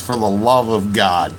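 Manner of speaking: mock weary 'for the love of God'; imitating his catchphrase. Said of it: "that's one of his famous sayings".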